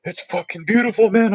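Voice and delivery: high, choked-up mock sob voice; imitating a teary buddy. Buddy Cash on the mountaintop moved to tears, high mock teary voice. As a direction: play up the friend crying on the summit. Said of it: "wiping tears away from his eyes".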